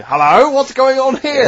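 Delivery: high, slow prying busybody voice; nosy neighbour. Acted. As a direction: poke your nose in, high and slow. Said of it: "Mister Nosy Neighbour".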